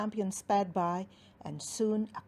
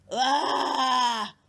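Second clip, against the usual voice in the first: giant whimper; 4 semitones higher, 8 dB louder than usual. Acted. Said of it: "whimpered Redbeard".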